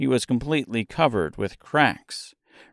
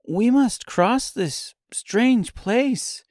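Dorothy's voice; 10 semitones higher than usual. A narrator's reading. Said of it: "said Dorothy".

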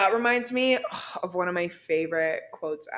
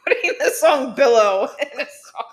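laughing; 3 semitones higher, 7 dB louder than usual. Retold or imitated. Mocking the lyric, laughing.

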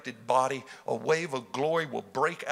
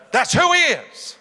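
louder and higher with each line; preaching build; 9 semitones higher, 9 dB louder than usual. Real, not acted. Preacher declaring holiness to the congregation, building loud.

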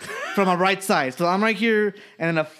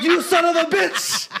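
high-pitched, laughing rant; hammed-up indignant tirade at being ignored; mock outrage; 9 semitones higher than usual. Customer to bartender after waiting five minutes, mock outraged.

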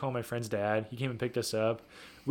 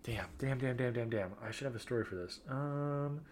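thinking hmm; 7 dB quieter, slower than usual. Real, not acted. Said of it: "I have to think really hard".

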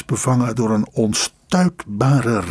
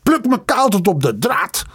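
high shrieking cry, higher; 8 semitones higher than usual. Acted. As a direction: screech it out in rage. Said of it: "krijschte hij".

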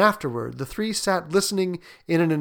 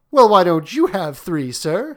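higher, pert question from a son; cheekily clever, pitched up; 4 semitones higher, 8 dB louder than usual. Acted. Herbert challenges the soldier about his own wishes, cleverly.

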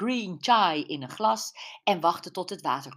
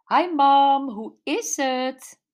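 daughter on the phone; 8 semitones higher, 7 dB louder, slower than usual. Acted. Daughter greets mother on phone.

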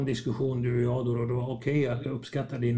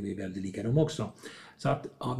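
normal talking voice

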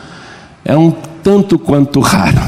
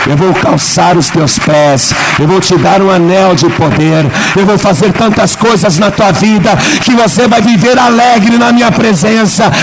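preaching, building up; 7 semitones higher than usual. Acted. Pastor voicing God's promises to the church, building preaching. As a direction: voice climbs higher as the promises pile on.

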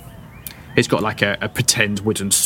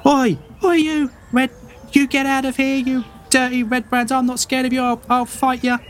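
put-on very high, brave-faced little boy shouting; a nervous kid puffing himself up against strangers; scrappy farm boy; 12 semitones higher than usual. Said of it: "and he's like".

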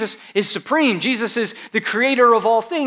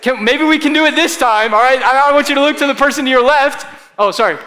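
ordinary talk

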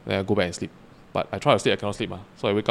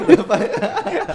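very high helpless laugh; real laughing fit; 4 dB louder than usual. Real, not acted. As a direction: burst out laughing at the absurdity. Cracking up at his own story.

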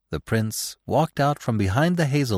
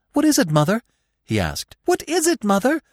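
alarmed question; 13 semitones higher, 4 dB louder than usual. A narrator's reading. Frightened fawn questions fleeing mother.

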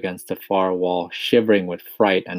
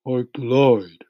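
primly corrected, slightly higher; prim earthworm; 5 semitones higher, 5 dB louder than usual. A narrator's reading. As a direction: correct him with mild primness. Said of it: "said the earthworm primly".

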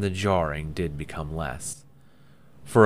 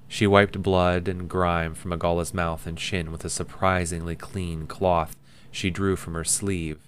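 ordinary delivery

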